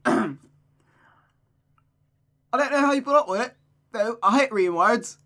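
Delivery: loud, high mock-British grumble; Gavin Free impression. Character complaining about reading, exaggerated British accent. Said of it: "Make her sound like Gavin Free".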